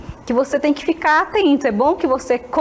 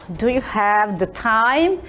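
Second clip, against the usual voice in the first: very slow careful question; slow model phrase; much slower than usual. Shown as a demonstration. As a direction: stretch it out for learners. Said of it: "Que além dessa". (pergunta bem devagar)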